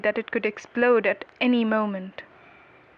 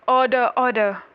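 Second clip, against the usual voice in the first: louder, commanding judge's call; calling to order; 3 semitones higher, 7 dB louder than usual. A narrator's reading. Judge silences a noisy courtroom, calling to order.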